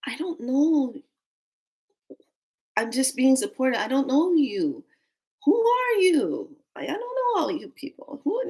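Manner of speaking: high, stretched comic protest; inner protest. Retold or imitated. Newcomer silently objects to strangers, mock high-pitched protest. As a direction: wail it like an overwhelmed thought. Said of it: "in my mind, I'm like".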